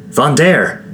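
worried question. A narrator's reading. Quinn checking on the stricken Vondair, an anxious high question. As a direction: ask Vondair? in alarm, voice jumping high. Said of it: "Quinn asked".

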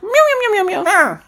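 a string of squeaky, very high mock meows; cat meows. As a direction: play the cat defending them with silly meows. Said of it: "then Luna's like".

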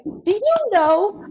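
high, slow proud puppy voice; lightly voiced eager pup; eager dog. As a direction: play the dog bursting with pride. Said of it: "was like".

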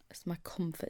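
soft breathy aside; whisper. Real, not acted. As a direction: hush it like a secret. Cosy confession about baggies.

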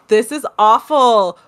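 ordinary delivery

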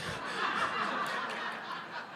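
breathy, high burst of laughter; soft high self-mocking laugh; high unvoiced laugh. Real, not acted. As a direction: laugh at yourself, quietly. Laughing at his own story.

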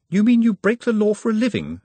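high-pitched, mildly appalled question; horrified disbelief. A narrator's reading. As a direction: ask it in polite horror.

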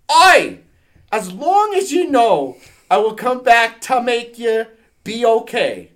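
loud, high mock-Scottish, drawn out; put-on Scottish accent. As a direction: exclaim in a hammed-up Scottish accent.

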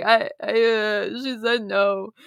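mildly acted drunken stammer; a hint of drunk, halting reply; imitated drunk. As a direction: stumble over it like a tipsy man, lightly played. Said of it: "all drunk".

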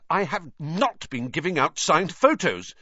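high, loud indignant denial; heated boy, pitched well up; hotly. A narrator's reading. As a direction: deny it hotly, punching "not". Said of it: "he said hotly".

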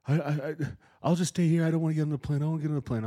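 put-on high, teary, pleading child's voice, loud; father retells his scared son bawling at the gate; imitating a crying kid. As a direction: mimic the boy begging not to board. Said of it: "he broke down fucking in tears".

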